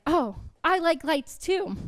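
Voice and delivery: slow, high, put-on naive voice; clueless old soul. Old soul misunderstanding a teenage cousin's lingo, mock naive. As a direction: draw it out, cluelessly trying to join in. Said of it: "think of myself as an old soul".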